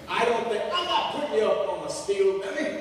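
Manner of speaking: lightly acted impatient foreman; exasperated boss. Foreman cutting off a worried worker, mildly exasperated. Boss cuts off his objection.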